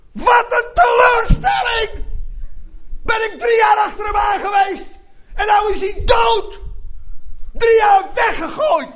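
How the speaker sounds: high, loud, bitter complaint from a follower; a disciple shouting his letdown; disappointed disciple. Acted. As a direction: rage at three wasted years. Disciple voiced in his dismay.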